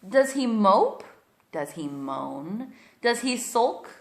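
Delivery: put-on storybook read-aloud for little kids; reading to children. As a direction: read it like bedtime story time. Reads picture book aloud to kids.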